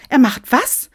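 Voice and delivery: loud, high outraged question; appalled. Acted. Equality officer hears about Sinterklaas, appalled. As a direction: react in shock, pitch shooting up. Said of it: "Die Gleichstellungsbeauftragte war entsetzt".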